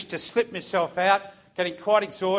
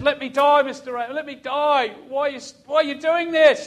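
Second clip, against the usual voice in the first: high, loud, desperate cry; high-pitched imitation of screaming; retold scream; 7 semitones higher, 5 dB louder than usual. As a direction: act out a man screaming in protest. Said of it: "he's screaming at me".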